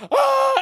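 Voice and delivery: high, loud laughing squeal; excited squeal. Retold or imitated. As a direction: squeal with giddy disbelief. Retold excited scream.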